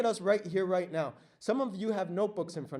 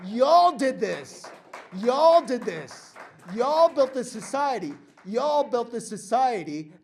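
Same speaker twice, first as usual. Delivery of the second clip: slow, loud, higher rallying cry to the crowd; rallying shout; 4 semitones higher, 9 dB louder, slower than usual. Real, not acted. Speaker telling workers in the audience they built society, shouting.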